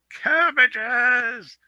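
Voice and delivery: very high rising goat shriek; distant goat cry. Acted. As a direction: cry out from far on the mountain. Distant goat cries doom.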